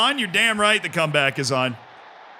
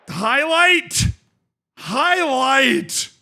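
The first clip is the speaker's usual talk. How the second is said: higher and louder, calling out to the game; eager shout; 7 semitones higher, 4 dB louder, much slower than usual. Real, not acted. Player yells at the game for a highlight, loud eager call.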